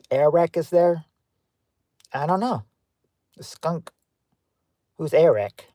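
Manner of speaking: ordinary talk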